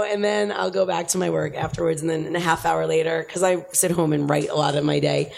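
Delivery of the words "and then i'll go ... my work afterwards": usual speaking voice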